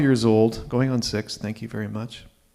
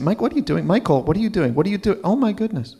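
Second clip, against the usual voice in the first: flustered grandmother; 8 semitones higher, faster than usual. Retold or imitated. Grandmother voiced in story.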